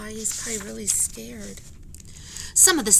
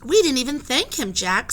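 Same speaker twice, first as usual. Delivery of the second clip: Annie's voice; 4 semitones higher, 6 dB louder than usual. Acted. Annie voiced in a read story.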